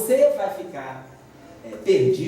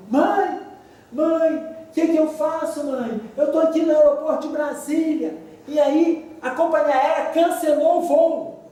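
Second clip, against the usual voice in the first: higher, louder mock crying daughter; 10 semitones higher, 5 dB louder than usual. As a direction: imitate the panicked daughter on the phone. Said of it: "E ela chorando no telefone".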